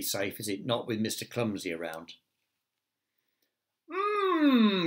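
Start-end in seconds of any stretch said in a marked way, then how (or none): 3.9-4.9 high exclaimed mm. Acted. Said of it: "exclaimed Mister Clumsy".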